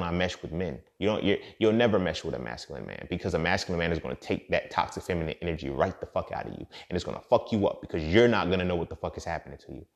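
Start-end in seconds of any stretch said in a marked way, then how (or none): none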